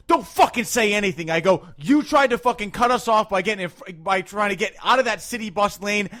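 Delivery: loud, worked-up reenacted rant; retold angry telling-off. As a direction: chew out a bad driver. Retold argument with a driver.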